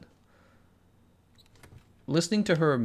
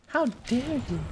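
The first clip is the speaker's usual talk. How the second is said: much higher, shrill mock indignation; mock outraged girl; slower than usual. Streamer mimicking the activist's famous line, high and shrill. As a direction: squeal the outrage like a scolding child. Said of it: "she said".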